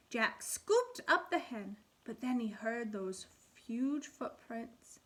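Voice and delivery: put-on lively storybook reading for kids; animated read-aloud for first graders; reading to children. A narrator's reading. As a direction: read it like a picture book at storytime. Teacher reads fairy tale aloud.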